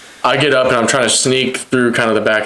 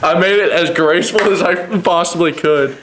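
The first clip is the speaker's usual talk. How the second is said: laughing; 5 semitones higher, slower than usual. Real, not acted. Laughing at own fall story.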